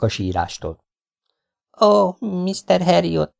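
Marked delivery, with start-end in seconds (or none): 1.8-3.3 high, slow and trembling. A narrator's reading. The tearful wife greets the vet, trembling voice. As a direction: let the voice quiver with worry. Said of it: "mondta remegő hangon".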